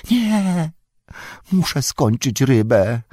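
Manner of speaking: high creature whine; stubborn refusal. Acted. Golum refusing to leave his fish, stubborn whine.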